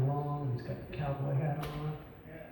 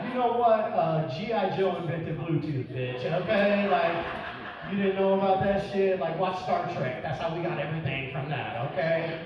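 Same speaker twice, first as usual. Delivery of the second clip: louder, indignant lecture; mock defensive rant; 5 semitones higher, 5 dB louder than usual. Comic arguing with his wife, loudly indignant. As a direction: bluster back like you're winning the argument.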